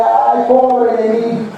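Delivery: slow, sing-song woe-is-me; drawn-out, hammed-up lament; mock self-pity. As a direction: wail slowly in exaggerated self-pity. Mocking self-pitying false mercy.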